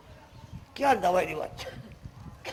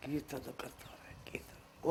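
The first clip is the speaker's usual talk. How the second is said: whisper; 8 semitones lower, 16 dB quieter than usual. Real, not acted. Preacher drops to a whisper.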